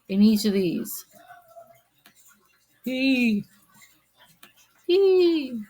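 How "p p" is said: playful squeak. Acted. Drawing peas, playful sound.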